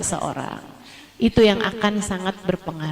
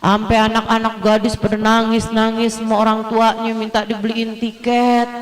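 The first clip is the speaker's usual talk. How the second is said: ordinary talk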